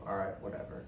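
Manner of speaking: quiet, low-energy brush-off; apathetic. Retold or imitated. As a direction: toss it off softly, as if past caring. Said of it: "and same apathetic".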